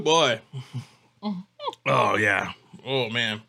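ordinary delivery

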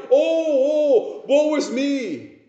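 high, drawn-out mock lament; hammed-up woe-is-me moan; mock whining. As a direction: imitate a congregation wailing in self-pity. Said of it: "just whine and whine".

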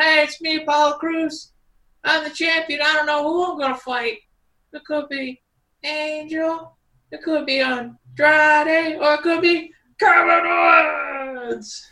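high, slow, awkward promo voice; nervous rookie promo, trailing off; wrestling promo. Retold or imitated. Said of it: "the most embarrassing thing I've ever seen".

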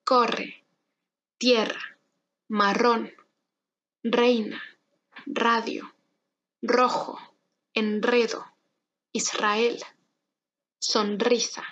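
word list from "Corre" to "sonrisa", each strong R trilled; strong R drill. Shown as a demonstration. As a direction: model each word carefully for repeating, rolling the R. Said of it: "Intenta conmigo".

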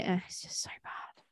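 under the breath, unvoiced whisper; whispered aside. Real, not acted. Complaining to friends about the town accent, in a whisper.

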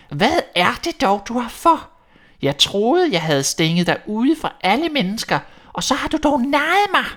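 furious witch. Acted. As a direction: rage at the girl who tricked her. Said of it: "råbte heksen".

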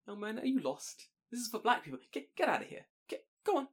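high, put-on disapproving stranger; acted indignant gatekeeper, high-pitched; mock shooing away.

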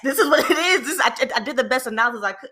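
high, laughing protest. Real, not acted. Defending the report to her friends, laughing protest. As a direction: laugh it off while pleading your case. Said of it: "laughing defence of her work".

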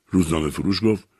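ordinary delivery, nothing marked

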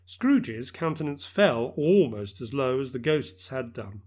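ordinary delivery

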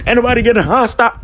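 hammed-up shrill protest; mock indignant. Denying being hostile.

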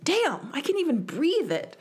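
lightly hammed-up exasperated teacher; mock teacher sigh. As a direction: play the teacher gasping at the lack of air. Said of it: "She would take, like, a deep breath and be like".

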